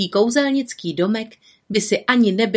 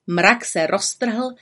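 ordinary delivery, nothing marked

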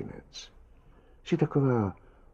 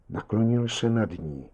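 ordinary delivery, nothing marked

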